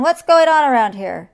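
roared. A narrator's reading. Lion hit by coconuts, roared. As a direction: bellow the question, put out. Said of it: "roared the lion".

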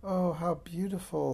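a touch of wonder; awed. A narrator's reading. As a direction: marvel at it, lightly voiced. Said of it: "said Alice in awe".

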